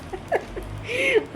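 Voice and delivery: a high burst of real laughter at what she said. Real, not acted. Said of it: "हंसी आ रही है भाई तेरी बातों से".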